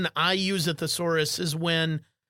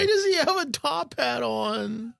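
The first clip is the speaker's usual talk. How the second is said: laughing question; 6 semitones higher than usual. Real, not acted. Laughing at horse picture.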